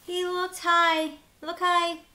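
playful loud searching call; excited search. Acted. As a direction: call it up to the sky. Searching game with kids.

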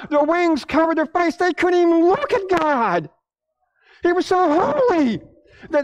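high-pitched, urgent sermon delivery; impassioned preaching. Acted.

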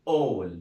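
a teacher modelling all; careful model of all; model pronunciation. Shown as a demonstration. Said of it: "L، L بيتنطقوا".

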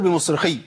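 بمصرخي stopped short on the khi; missing final ya. Shown as a demonstration. Teacher showing the common mistake, بمصرخي without its ya. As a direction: end بمصرخي quickly, no held y. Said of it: "فين الياء؟".

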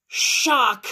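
loud, high cry of shock; big dramatic shock; acted shock. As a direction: blurt it out in storybook horror. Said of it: "Shock and horror and sadness".

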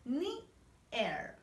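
"Near" split into two syllables, "ni... er", with a gap. Shown as a demonstration. Teacher models English for children to repeat, "Near" in separated syllables. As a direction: break "Near" slowly into "ni", pause, "er". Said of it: "Vamos a repetir".

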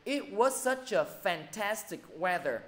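dictating sentence pace; teacher reading a sample sentence aloud; slow example reading. Shown as a demonstration. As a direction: read the English example slowly and clearly. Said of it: "Ví dụ".

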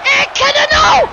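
very high, loud yell; shocked shout. Real, not acted. Commentator reacts to the match turning, shocked high shout.

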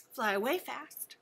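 quick and snappy; fast. Shown as a demonstration. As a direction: rattle it off fast.

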